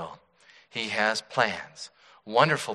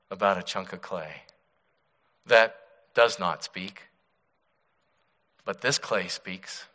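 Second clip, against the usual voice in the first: ordinary talk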